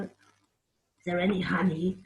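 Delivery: ordinary delivery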